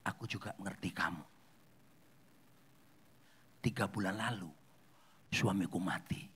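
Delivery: low, hushed confiding voice; imitated whisper. Bereaved woman whispering to the crying widow, hushed and low. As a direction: confide it softly, with long pauses. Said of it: "Lalu dia ngomong bisikan ke ibu itu".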